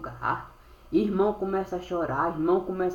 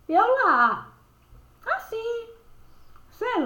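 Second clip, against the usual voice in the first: astonished; slower than usual. Acted. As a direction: gasp it out, amazed. Astonished aside in story.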